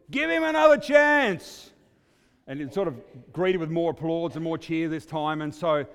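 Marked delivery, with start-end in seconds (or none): 0.1-1.7 high, slow yell from the back; shout. Retold or imitated. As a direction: call out across a stadium. Same heckler yelling from the back.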